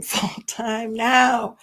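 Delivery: real laugh breaking into the words. Real, not acted. Reading a parent's account of the meeting, laughing. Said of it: "Sorry, I'm laughing".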